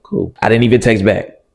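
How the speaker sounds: normal talking voice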